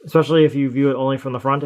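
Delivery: normal talking voice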